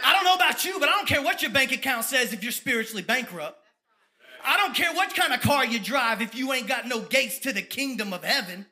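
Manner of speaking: preacher firing up the crowd, loud; fired-up preaching. Real, not acted. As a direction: preach it at full force. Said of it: "I get fired up".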